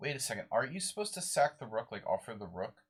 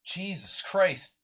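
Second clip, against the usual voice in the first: loud, high, drawn-out exclamation; exasperated outburst; 9 semitones higher, 8 dB louder, much slower than usual. Real, not acted. Chess player reacting to a mouse slip, exasperated outburst. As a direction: stretch out the oath, high and loud.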